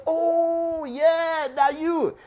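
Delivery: high, loud and slow, grudging then demanding; concede, then press him hard; grudging crowd. Retold or imitated. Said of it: "They said".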